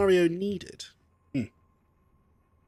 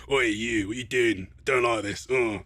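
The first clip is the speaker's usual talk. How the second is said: lower, gruff Manchester football-fan bark; Manchester accent; 6 semitones lower than usual. Shown as a demonstration. Streamer voicing his hard-boiled guy, lower Manchester accent. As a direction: square up like a Mancunian lad. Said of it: "sounds kinda like a Manchester United football fan".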